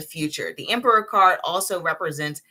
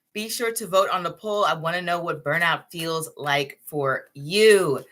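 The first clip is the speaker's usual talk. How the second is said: usual speaking voice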